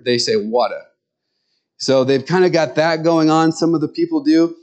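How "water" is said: "water" in an Eastern accent, lower, ending softened. Shown as a demonstration. Showing how some Michigan people say water, Eastern accent. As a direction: say "water" like an Easterner, dropping the final r. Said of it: "they have kind of an Eastern".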